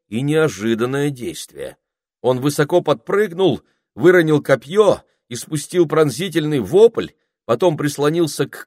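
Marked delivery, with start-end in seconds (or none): none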